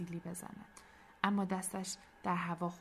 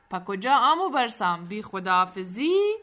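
mocking call; 11 dB louder than usual. Sultan stops departing Barsam for a goodbye, loud taunting. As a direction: stretch the last question out teasingly. Mock farewell to arrested villain.

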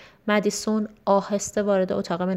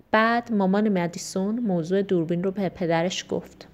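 ordinary delivery, nothing marked

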